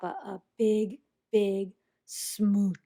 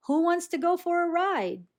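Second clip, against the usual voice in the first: excited offer; 9 semitones higher, 5 dB louder than usual. Acted.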